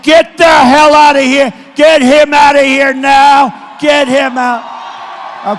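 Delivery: full-throated shout, slowing to a drawn-out final get him out; screaming order. Retold or imitated. Retelling the rally, throwing out the protester, shouting. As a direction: bellow it, stretching the last command. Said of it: "And I'm screaming".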